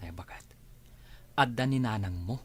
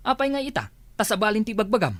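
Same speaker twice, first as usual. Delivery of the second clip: sharp retort; 8 semitones higher, 5 dB louder than usual. Acted. Couple quarrel, sharp question.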